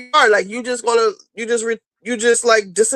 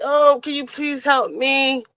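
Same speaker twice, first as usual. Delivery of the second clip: mock crying plea; 7 semitones higher, slower than usual. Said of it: "crying, like".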